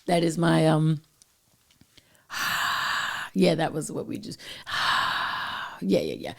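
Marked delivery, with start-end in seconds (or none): 2.3-3.3 soft, voiceless exhale; quiet, drawn-out sigh; long breathy sigh. Real, not acted. Catching breath after singing. 4.7-5.8 soft, voiceless exhale; quiet, drawn-out sigh; long breathy sigh. Real, not acted. Reacting after singing.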